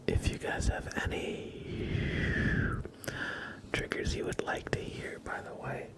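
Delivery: unhurried breathy ASMR murmur; ASMR whisper. Acted. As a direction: whisper gently to the chat like ASMR. ASMR stream intro whispered.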